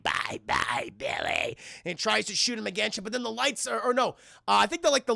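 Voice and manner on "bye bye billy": rasped, croaking creature farewell; gremlin villain voice. Retold or imitated. Streamer as Stripe about to shoot Billy, rasping villain voice. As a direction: croak a menacing goodbye as a gremlin.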